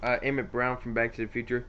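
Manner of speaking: usual speaking voice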